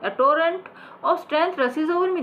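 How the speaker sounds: high, very slow surge of pride; hammed-up stage declamation; rousing declamation. Shown as a demonstration. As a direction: swell with strength as you say it. Acting the old actor.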